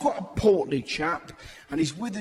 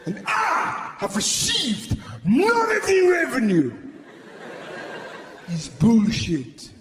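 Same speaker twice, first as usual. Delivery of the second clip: indignant penguin. Pretend. Penguin-complaint character rant.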